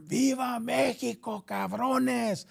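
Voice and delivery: high, drawn-out mock holler; mock drunken battle cry. Drunk gunman hollers before firing, imitated battle cry. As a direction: stretch it out like a rowdy cry, without full volume.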